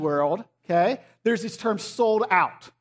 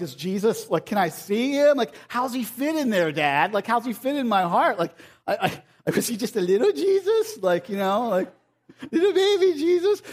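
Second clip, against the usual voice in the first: put-on a small child puzzling over Jesus in his heart; curious little kid; 3 semitones higher than usual. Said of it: "they were like".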